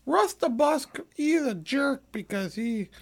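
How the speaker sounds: mock commenter. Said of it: "one of the people are commenting, like".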